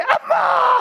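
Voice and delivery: high, loud wail of 엄마; mock wailing cry. Preacher imitates a daughter rushing into her mother's funeral, wailing. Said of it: "뭐라 그래".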